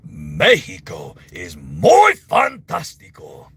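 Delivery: loud, very slow cartoon voice; booming, drawn-out character voice; Bob. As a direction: stretch it out with big theatrical relish. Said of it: "What does Bob think about Mexico?".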